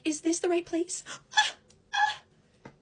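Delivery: high, loud mocking squeal of her voice; mocking shrill imitation. Mocking Krissa's excited phone call in a screechy voice. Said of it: "You were like".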